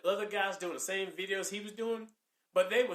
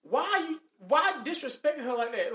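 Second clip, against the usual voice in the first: higher, louder indignant challenge; mock exasperated challenge; 6 semitones higher, 5 dB louder than usual. Speaker confronting a man who insulted a woman, exasperated. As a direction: call him out, exasperated.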